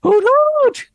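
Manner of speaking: owlbear voice. Acted. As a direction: repeat the hoot even bigger. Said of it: "What was that again?".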